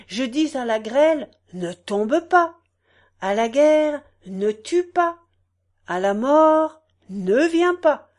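ringing, declaimed sales pitch to the crowd; candidate's stump harangue. Acted. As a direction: proclaim grand promises like a fairground orator.

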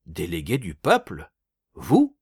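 scornful, rising on Vous; outraged incredulous cry; furious disbelief. A narrator's reading. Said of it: "s'écria Lacombe furieux".

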